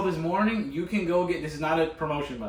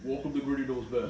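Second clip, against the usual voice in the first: forcing a deep voice; 3 dB quieter than usual. Pretend. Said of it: "You're literally pretending".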